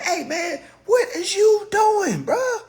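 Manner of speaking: high, laughing slow shout of what is you doing; calling out a friend. Acted. Friend catching him slipping, laughing high shout. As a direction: yell at a buddy who is slipping. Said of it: "if y'all see me slipping".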